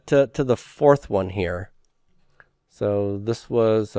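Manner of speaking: usual speaking voice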